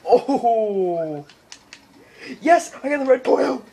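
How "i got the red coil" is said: ordinary talk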